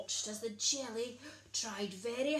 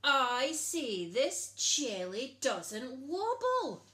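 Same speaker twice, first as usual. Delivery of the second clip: slow, louder, insistent princess; hysterical screech; 3 semitones higher, 7 dB louder, slower than usual. A narrator's reading. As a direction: stamp each word out in a tantrum. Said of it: "screeched the princess hysterically".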